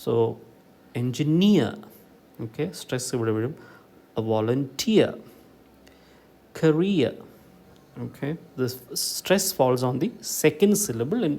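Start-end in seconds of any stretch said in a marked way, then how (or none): 1.0-1.7 engineer with NEER punched; light engi, strong NEER in engineer; stress on last syllable. Shown as a demonstration. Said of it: "the last syllable is". 4.2-7.1 volunteer and career, punched on TEER and REER; volunteer, career, each with a strong final syllable; stress on last syllable. Shown as a demonstration. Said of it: "the last syllable is".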